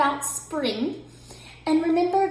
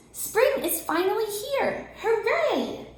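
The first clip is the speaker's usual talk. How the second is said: high, slow, cheering little mouse; high happy squeak of a mouse; excited Mouse; 9 semitones higher, slower than usual. A narrator's reading. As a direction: draw out the joy, then cheer. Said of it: "said Mouse".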